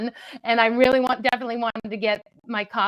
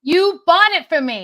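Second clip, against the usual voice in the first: louder retort; 6 semitones higher, 7 dB louder than usual. Real, not acted. Arguing louder with husband.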